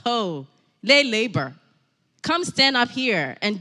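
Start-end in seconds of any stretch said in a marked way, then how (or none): none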